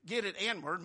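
normal talking voice

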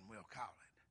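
ordinary talk, nothing marked

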